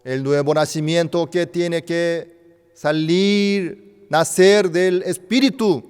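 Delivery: loud, high fervent preaching; loud, emphatic sermon climax; full-force preaching. Acted. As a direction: drive the point home to the flock.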